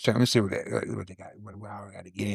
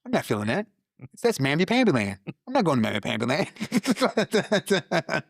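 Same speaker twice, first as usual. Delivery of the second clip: mock dismissive; 8 semitones higher than usual. Mocking refusal of counseling.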